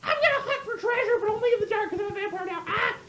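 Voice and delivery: put-on very high, fast, laughing girlish voice; high giggly schoolgirl; giddy schoolgirl vampire. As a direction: squeal it like a giddy girl. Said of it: "And she's just like".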